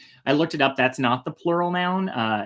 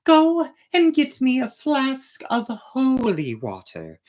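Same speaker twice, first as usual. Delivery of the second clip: much higher, sweet princess voice, slowed in places; a princess sweetly asking the emperor for one more thing; princess Ileana, coy request; 10 semitones higher than usual. Acted. Princess sets a final task.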